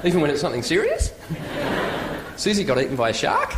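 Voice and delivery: serious news ending on a questioning rise; uptalk. Shown as a demonstration. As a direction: swing "serious" and "shark" up at the end. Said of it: "we all go up at the end".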